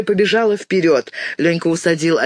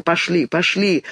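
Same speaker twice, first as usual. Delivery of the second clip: usual speaking voice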